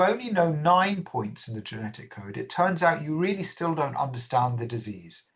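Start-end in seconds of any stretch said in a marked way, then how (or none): none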